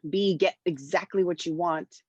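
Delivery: usual speaking voice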